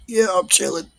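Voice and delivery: high, tight held-breath voice; holding smoke in. Retold or imitated. To great aunt, pretending to be chill, held-breath high voice. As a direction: talk high with lungs full. Still holding the vape hit.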